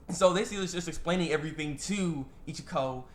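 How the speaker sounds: ordinary talk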